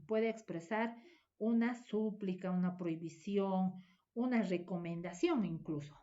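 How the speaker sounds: normal talking voice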